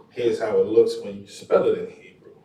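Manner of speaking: normal talking voice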